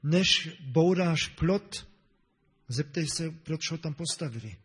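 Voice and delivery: deliberate and weighty, as a proverb; než bouráš plot, spoken very slowly and firmly; slow quoted saying. Retold or imitated. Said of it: "Někdo řekl". (pomalu a důrazně pronesené přísloví)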